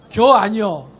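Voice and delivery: 충청도 사투리로 툭; Chungcheong dialect. Retold or imitated. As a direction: drop it punchily in Chungcheong dialect. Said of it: "가장 함축적이에요".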